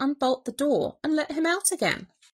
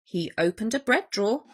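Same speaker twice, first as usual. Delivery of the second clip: usual speaking voice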